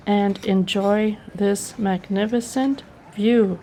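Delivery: ordinary talk, nothing marked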